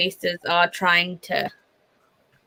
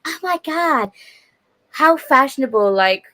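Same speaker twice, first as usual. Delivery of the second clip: high, mock-gushing fan voice; gushing fashion fans; 4 semitones higher than usual. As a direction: ham up the swooning admirers. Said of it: "everyone was like".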